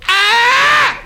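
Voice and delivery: loud high-pitched held scream; sustained comic yell of agony; scream of pain. Acted. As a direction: shriek from the dentist's chair. Patient's scream from back room.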